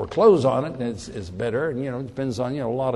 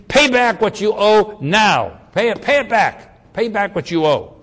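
angry demand; 6 semitones higher, 7 dB louder than usual. Acted. Slave throttles his fellow slave, loud angry demand. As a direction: bark the debt at him as you choke him. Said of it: "seized him, began to choke him, saying".

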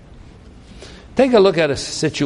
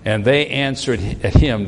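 ordinary talk

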